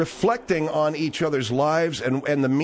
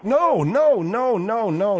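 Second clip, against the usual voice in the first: alarmed denial; 7 semitones higher, much slower than usual. Real, not acted. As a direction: jump in fast to deny it. Host hastily denying an insult.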